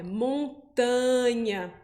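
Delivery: montanha said slowly with a nasal mõn, Brazilian style; Portuguese montanha. Shown as a demonstration. Teacher contrasting Portuguese montanha with French. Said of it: "o A fica com som de an".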